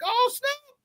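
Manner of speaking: high squeaky-register exclamation; voice up an octave. Retold or imitated. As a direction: leap way up into falsetto range. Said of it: "My voice went up an octave too".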